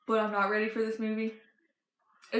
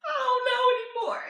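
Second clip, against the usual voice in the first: high crying wail; hammed-up tearful cry at top pitch; mock bawling; 13 semitones higher than usual. Granddaughter overwhelmed by the trailer, high mock-crying wail. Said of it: "I started bawling, crying".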